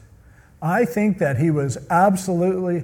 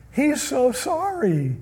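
high, drawn-out mock sorrow; hammed-up sorry voice, slow and high; mock remorse; 9 semitones higher than usual. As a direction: stretch it out high, as if weepy with regret. Mimicking Saul's repeated tearful remorse.

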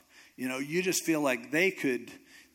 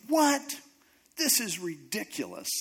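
Naaman, furious; 5 semitones higher than usual. Retold or imitated. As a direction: act out an indignant retort. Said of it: "Naaman just gets furious".

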